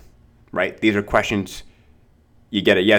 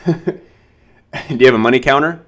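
laughing question; 5 semitones higher, 4 dB louder, slower than usual. Shown as a demonstration. Joking loaded question to client.

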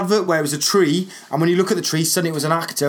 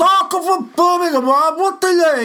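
Rusty Lee impression; 14 semitones higher, 4 dB louder than usual. Mimicking Rusty Lee's loud high Brummie voice for his cohost. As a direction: belt it out high like a TV cook. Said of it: "this is exactly how she spoke".

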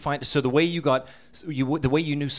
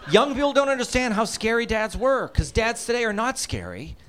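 ordinary talk

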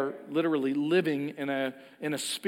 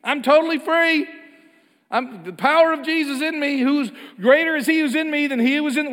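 high, loud mock boast of being free; sarcastic sing-song of easy faith; mock triumphant; 11 semitones higher, 9 dB louder than usual. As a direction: ham up the glib believer's claim. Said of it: "we're gonna sing songs about it".

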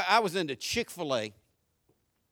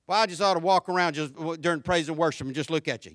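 normal talking voice

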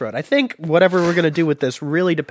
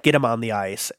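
ordinary talk, nothing marked

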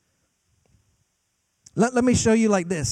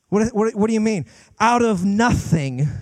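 ordinary talk

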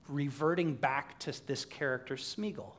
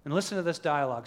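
normal talking voice

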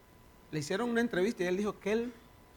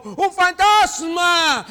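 loud, high, slow cry of fright; frightened cry; 9 semitones higher, 14 dB louder, much slower than usual. Acted. Disciples seeing a figure on the sea, terrified loud cry. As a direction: shriek it like terrified sailors. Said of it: "dieron voces de miedo".

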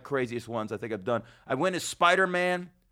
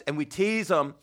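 usual speaking voice

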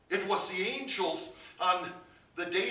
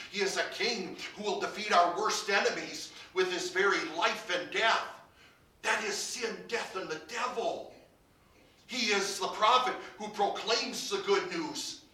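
louder, ringing proclamation; preaching at full force; 3 semitones higher, 6 dB louder than usual. Acted. Preacher proclaiming Christ as king to believers, full force. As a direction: announce his victory to the hall.

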